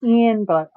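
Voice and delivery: Envelope said as "EN-velope"; everyday "EN-velope" for Envelope. Shown as a demonstration. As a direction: start "Envelope" with EN as in pen. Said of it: "I always pronounce it envelope".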